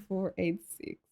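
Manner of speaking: ordinary talk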